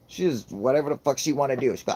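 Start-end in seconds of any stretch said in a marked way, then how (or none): none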